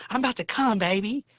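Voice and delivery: lightly put-on high bedroom voice; imitated lover. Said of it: "And he be like".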